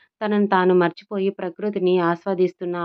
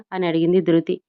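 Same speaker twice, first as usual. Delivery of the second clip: ordinary delivery, nothing marked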